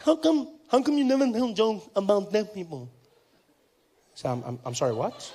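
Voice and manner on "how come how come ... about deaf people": deaf man's speech. Retold or imitated. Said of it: "He said".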